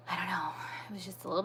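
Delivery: fast, overwhelmed whisper; whispering, overcome after telling the story. Real, not acted. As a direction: rush it out under your breath. Emotional end of story.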